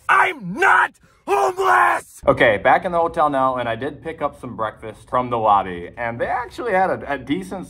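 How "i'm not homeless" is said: high, slow, drawn-out outraged yell; exasperated shout. Real, not acted. Mistaken for homeless by the parking attendant, furious shout. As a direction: yell it out in disbelief, stretching every word.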